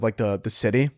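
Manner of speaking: ordinary delivery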